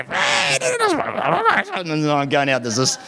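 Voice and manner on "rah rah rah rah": high mock squabble rah rah; squawking girls. Teenage girls yelling back at a middle-aged woman, squawking mockery. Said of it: "the girls say".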